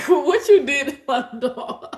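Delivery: slow, laughing mock outrage; laughing accusation. Real, not acted. Laughing accusation over scared dog.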